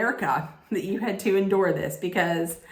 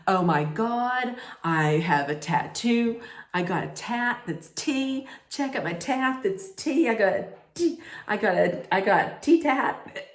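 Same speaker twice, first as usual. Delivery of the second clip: excited, higher-pitched mock gushing; hammed-up tea fanatic, pitch jumping up; gushing contestant; 4 semitones higher than usual. [name] telling the ladies about her tea tattoo, mock gushing.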